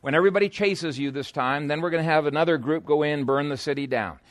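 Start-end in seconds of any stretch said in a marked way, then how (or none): none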